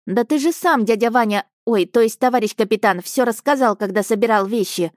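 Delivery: put-on a small boy reporting eagerly to his captain; boy's voice. Child Alyosha voiced by narrator.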